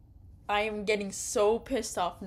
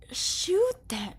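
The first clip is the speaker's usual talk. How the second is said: high whispered plea to shoot; whispered urging; 10 semitones higher, 3 dB louder than usual. Real, not acted. Gamer urging the hero to shoot, high whisper. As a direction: hiss it high and urgent.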